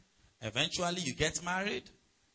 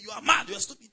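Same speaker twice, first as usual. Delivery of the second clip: mock insult; 5 semitones higher, 5 dB louder, faster than usual. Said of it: "you don't see me saying".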